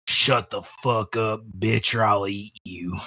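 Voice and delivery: low, slow, quiet menace; menacing threat. Acted. Character blurting a threat at the woman, low and menacing. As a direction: growl the threat softly. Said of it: "what really came out of my mouth was".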